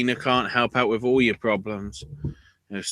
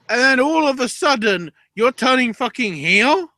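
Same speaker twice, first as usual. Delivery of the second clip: high, loud, slowed shouting; wrestling fan raging at a sudden heel turn; outraged disbelief; 13 semitones higher, 4 dB louder than usual. Real, not acted. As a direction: rant in full disbelief at the twist. Outraged rant at the storyline.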